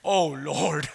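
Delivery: low, slumping groan of a prayer; heavy cry to God. Shown as a demonstration. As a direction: let it sink low, weighed down.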